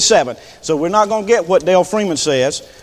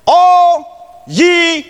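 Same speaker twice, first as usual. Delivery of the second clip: slow proclaimed phrase; 12 semitones higher, 6 dB louder, much slower than usual. Acted. Repeating psalm phrase.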